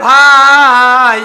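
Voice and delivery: one word stretched very long and loud; mock loud cry. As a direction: bellow it out in a long chanted call. Brother backing away, acted calling out. (অনেক টেনে জোরে ডাক)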